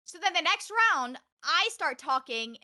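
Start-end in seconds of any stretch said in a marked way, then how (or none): none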